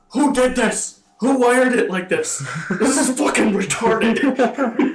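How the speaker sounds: loud, high-pitched mock outrage; high, shouted impression of an indignant coworker; Brian. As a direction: imitate an exasperated rant over bad wiring. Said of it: "When Brian was unwiring his wire".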